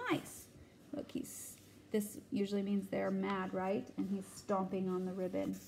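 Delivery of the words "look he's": whispering. Real, not acted. Whispering to the listener.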